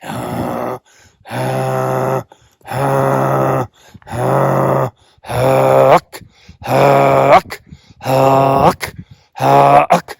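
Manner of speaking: short pushed honking huck calls; goose call huck. Shown as a demonstration. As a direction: push each huck from the throat like a goose call. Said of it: "I'm gonna teach my larynx to hum".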